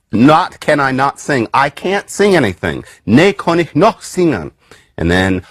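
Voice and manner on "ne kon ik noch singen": put-on reconstructed Old English accent with a Germanic lilt; half-invented Old English accent. Shown as a demonstration. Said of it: "I don't know what accent this is".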